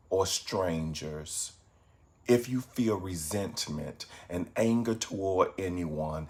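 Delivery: soft, unhurried meditation voice with long pauses; guided meditation. Acted. Meditation teacher guiding evening viewers to reflect on goodness, guided meditation. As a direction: guide them gently into reflection, low-key and calm.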